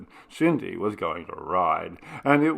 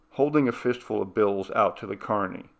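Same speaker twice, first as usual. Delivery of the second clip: usual speaking voice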